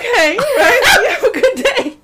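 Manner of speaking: high, slow, giggly brush-off; laughing fake politeness. Store clerk laughing as she politely dismisses him. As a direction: sing it out with a laugh.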